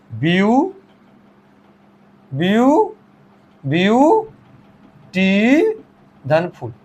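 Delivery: "ब्यूटीफुल" broken slowly into ब्यू, टी, फुल; syllable by syllable. Shown as a demonstration. Teacher counting three syllables in "ब्यूटीफुल", slowly syllable by syllable. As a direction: sound out "ब्यू-", pause, then finish "ब्यूटीफुल" in pieces. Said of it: "ये तीन syllable इसके अंदर हैं".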